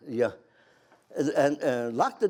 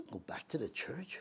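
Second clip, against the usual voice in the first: whisper; 14 dB quieter than usual. Real, not acted. Whispered aside in story.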